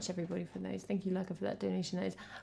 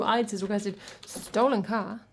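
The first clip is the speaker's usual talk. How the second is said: ordinary talk, nothing marked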